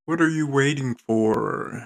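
loud hyped ad voice; loud ad call. Acted. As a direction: call out like a radio ad. Ad read opening, loud call.

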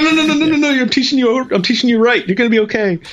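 high laughing no no no; flustered high reassuring; laughing high reassurance. Retold or imitated. Retold frantic reassurance to student.

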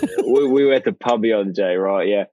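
put-on grungy British pub talk; grungy British accent. Shown as a demonstration. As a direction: fake a British lad telling a pub story. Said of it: "You got a bit more grungy".

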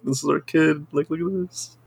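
high, soft pretend parent; mock doting parent. To imagined companion at the ultrasound, mock doting. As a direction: play at showing off a baby, hushed and high.

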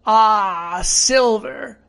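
drawn-out exclamation, rising in pitch; alarmed sailor's drawn "Ah"; castaway character. Acted. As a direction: recognise a dreaded name.

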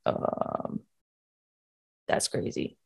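breathy awed whisper; stunned whisper. Retold or imitated. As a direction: whisper it in disbelief. Stunned whisper after dog stops.

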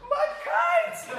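put-on very high, drawn-out little-kid wail; hammed-up high-pitched childish sobbing cry; mock crying. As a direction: imitate a small boy bawling over a loss. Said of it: "and he's crying".

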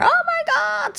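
very high, put-on oh my god; mimicking shrieking tourists in the bar; imitated tourist squeal. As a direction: squeal it like a giddy party tourist. Imitating the English-speaking crowd.

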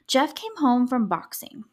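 usual speaking voice

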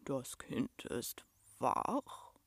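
low, very slow, soft monster rumble; deep rumble. A narrator's reading. Furry giant notices the child waking, deep slow rumble. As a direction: rumble it out like a huge beast. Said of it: "hörte sie eine tiefe Stimme brummen".

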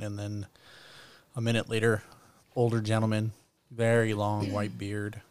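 usual speaking voice

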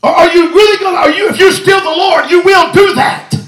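shouted, rising preacher's cry; preaching at full force. Real, not acted. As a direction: preach it hard and high to the church.

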